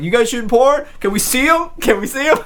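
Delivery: high, eager mock pleading; hammed-up eager guys; eager onlookers. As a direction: beg high like excited neighbors. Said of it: "They're like".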